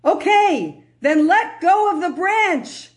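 high, loud, slow command to let go; lightly voiced God giving the test; God tells David to let go; God's instruction. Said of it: "He says".

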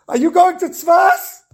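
high, loud, drawn-out desperate question; tearful plea. Retold or imitated. Crying souls ask the scared traveller, high imploring wail. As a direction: ask it as an imploring cry, without real sobs. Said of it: "they started crying".

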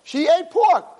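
high, insistent accusation; outraged Satan. Acted. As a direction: act out an indignant accuser. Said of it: "he's freaking out".

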